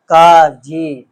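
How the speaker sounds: काफ called out loud and firm; letter name called out. Shown as a demonstration. Teacher to pupils, naming the letter काफ loudly. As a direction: announce काफ clearly to the class. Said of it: "क्या है".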